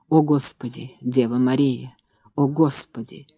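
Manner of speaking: horrified hush. A narrator's reading. Mother's horrified whispered prayer.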